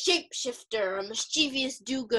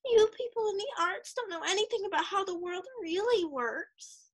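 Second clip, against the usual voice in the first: high-pitched indignant put-down; huffy; 8 semitones higher than usual. Acted. Roommate putting down people in the arts, huffily. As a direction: scold in a raised, huffy voice. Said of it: "she would huff".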